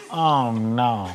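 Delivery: soft, low, laughing oh no; older lady. Retold or imitated. Imitating a crab-cracking lady unimpressed by his joke, soft and low. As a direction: murmur it like a disappointed older lady. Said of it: "this lady just going".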